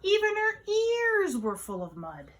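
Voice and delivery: high, slow, animated narration falling at the end; playful storytime emphasis; exaggerated storytelling. A narrator's reading. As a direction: stretch it out for little listeners. Comic exaggeration for young listeners.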